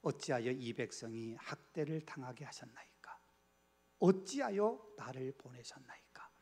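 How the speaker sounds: whispered plea to God; whispered lament. Acted. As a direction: whisper the prayer like a desperate confession. Moses' lament quoted from scripture. (속삭이는 탄식)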